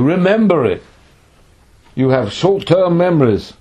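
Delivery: high, loud rebuke; scolding reminder. Acted. God to the nations' former captives, scolding. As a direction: chide them loudly for forgetting.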